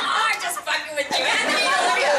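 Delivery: loud, high-pitched mock reassurance; teasing reveal. Retold star letting a nervous actor off the hook, loudly teasing.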